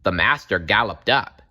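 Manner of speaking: ordinary delivery, nothing marked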